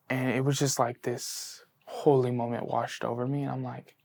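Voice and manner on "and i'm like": normal talking voice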